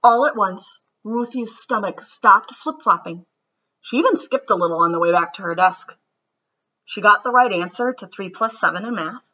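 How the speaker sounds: gentle storybook read-aloud; children's story narration. A narrator's reading. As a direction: read it like bedtime story time. Reads picture book aloud.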